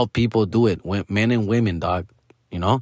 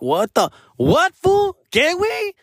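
very high, disbelieving squeal; squeaky mock shock. To the acting teacher, a squeaky disbelieving squeal. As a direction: jump way up in pitch in disbelief. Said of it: "I'm like".

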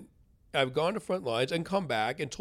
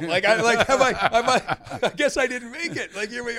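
laughing disbelief; 11 semitones higher, 12 dB louder than usual. Real, not acted. Joking he landed in hell.

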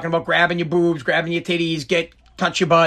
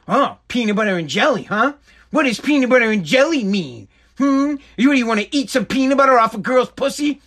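rising higher into accusing teasing; mock suspicious wife; 5 semitones higher than usual. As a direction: build from sly suspicion to a high accusing jab. Mocking wife's suspicion.

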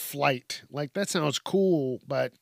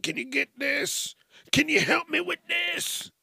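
much higher nagging voice; whiny pestering; 14 semitones higher than usual. Retold or imitated. People pestering a superhero, whiny and high. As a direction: beg for favors, pitched up.

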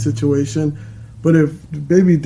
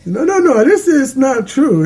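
high, quick no-no-no protest; excited protest; 8 semitones higher than usual. Real, not acted. Protests right after.

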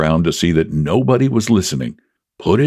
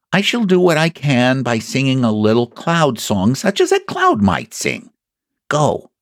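lightly voiced bear, pitched up; scheming Pooh; 6 semitones higher than usual. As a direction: lay out the plan in a rising bear voice.

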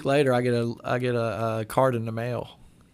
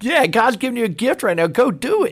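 much higher, pushing himself to act; urgent inner voice; 11 semitones higher, 6 dB louder than usual. Retold or imitated. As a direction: snap yourself out of it, high and insistent. His own inner voice urging.

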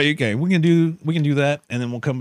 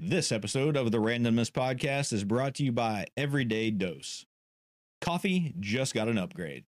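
ad read; 2 semitones lower, 10 dB quieter than usual. Acted. Reads sponsor ad.

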